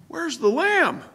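Where's the LAMB, LAMB cried out high and falling; stress on lamb. Shown as a demonstration. Preacher stressing the sacrificial lamb, lamb punched high. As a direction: hit lamb hard and high. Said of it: "Special emphasis upon the word lamb".

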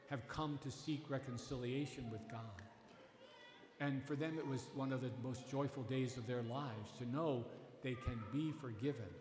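normal talking voice

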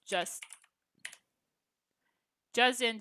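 normal talking voice

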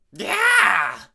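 loud, high yelp of shock; startled shout. A narrator's reading. Peacemaker splashed awake with water, startled shout. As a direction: jolt awake with a yell. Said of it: "Peacemaker shouted as he felt water".

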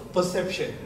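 ordinary delivery

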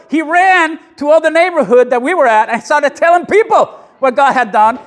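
rising, loud with excitement; excited preaching. Real, not acted. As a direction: build the joy of the healing. Excited retelling of healing.

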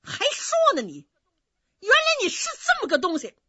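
high, loud furious scold; angry scolding. Acted. Innkeeper's wife scolds the rude general, furious and loud. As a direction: tear into him like an outraged mother. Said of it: "张嘴就骂".

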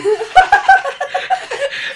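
helium laugh. Real, not acted. Squeaky laugh, balloon gas.

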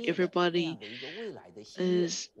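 ordinary delivery

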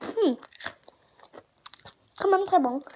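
eating snack while speaking; chewing while talking. Real, not acted. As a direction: mouth partly full of chips. Seen 2: Holds shrimp chip at lips, mouth working while saying "hmm.

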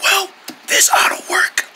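hopeful voiceless whisper; lost voice. Pretend. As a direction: whisper it with no voice left. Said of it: "I think I lost my voice".

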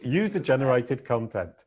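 slow, showy English accent. Shown as a demonstration. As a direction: show off your English accent on the term. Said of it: "c'est que là où j'ai un talent".

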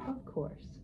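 softly, coolly self-assured; understated cool confidence. A narrator's reading. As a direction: toss it off quietly, with cool assurance. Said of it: "thought Pasha coolly".

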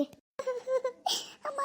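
drawn-out, very soft high moan of pain; woman in labour moaning long and soft in pain. Acted.

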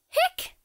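short squeaky hiccup sound; a sharp little hiccup; high hiccup. Acted. Character has the hiccups.

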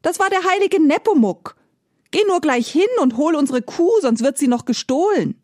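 wife crying out at her foolish husband; wife calling out. Acted. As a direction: scold him loudly, high-pitched. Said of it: "rief die Bäuerin".

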